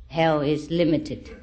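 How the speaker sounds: ordinary talk, nothing marked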